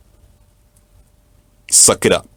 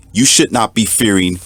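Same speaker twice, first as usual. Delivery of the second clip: ordinary talk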